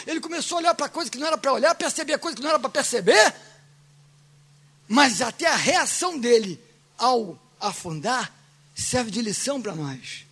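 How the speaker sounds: normal talking voice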